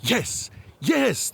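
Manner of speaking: a quick, eager "yes, yes" pitched high. Acted. Artaban eagerly answers the woman, high and quick. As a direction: jump at the news. Artaban hears of his friends.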